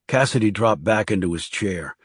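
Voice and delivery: usual speaking voice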